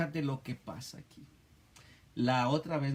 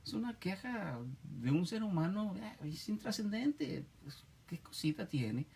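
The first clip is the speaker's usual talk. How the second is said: dismissive objector; 6 dB quieter than usual. Retold or imitated. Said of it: "tú puedes decir".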